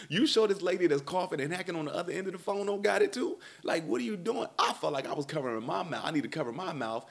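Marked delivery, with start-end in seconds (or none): 0.1-4.5 high, exasperated disbelief; incredulous. Retold or imitated. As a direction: pitch it up like you can't believe your ears. Warning about the coughing lady.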